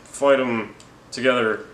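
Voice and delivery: hammed-up offer to team up; mock hero pledge. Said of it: "they each find out".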